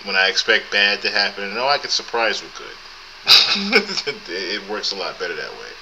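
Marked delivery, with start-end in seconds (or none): 3.2-4.2 high laugh; short high burst of laughter. Real, not acted. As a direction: chuckle at the irony. Laughing at own motto.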